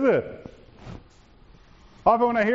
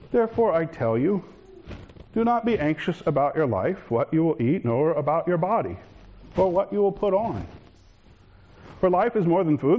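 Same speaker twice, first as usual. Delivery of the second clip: lower, measured Bible reading voice; scripture reading; 3 semitones lower than usual. Acted. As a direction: read the gospel gently and solemnly. Reads the gospel text.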